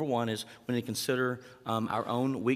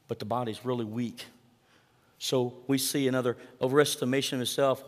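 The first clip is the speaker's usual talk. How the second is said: ordinary talk, nothing marked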